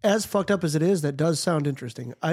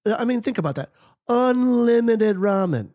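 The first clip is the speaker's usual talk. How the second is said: mock excitement; 7 semitones higher than usual. Joking about prison ramen.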